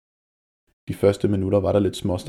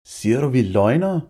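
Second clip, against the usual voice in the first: bully challenging Jonas, higher and louder; indignant outburst; 4 semitones higher, 4 dB louder than usual. A narrator's reading. Malte confronting Jonas, indignant outburst. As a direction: snap back, offended and threatening. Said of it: "udbrød Malte".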